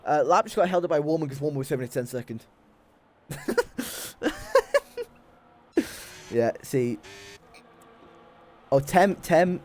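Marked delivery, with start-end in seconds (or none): 3.3-5.0 long, high fit of giggles; high, trailing laugh; laughter. Real, not acted. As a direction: laugh helplessly for a moment. Amused at race.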